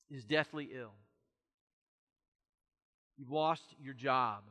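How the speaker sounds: usual speaking voice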